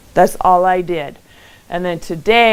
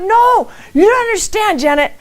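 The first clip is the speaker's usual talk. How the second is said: high, loud insistent retelling; freaking out; 14 semitones higher, 4 dB louder than usual. Retold or imitated. Woman insisting to her calm friend, high freaked-out protest. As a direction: protest in re-enacted over-excitement. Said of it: "I was freaking out".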